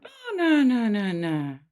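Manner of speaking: put-on mother comforting an upset baby, cooing; soothing motherese. Shown as a demonstration. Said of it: "that's a very different tone".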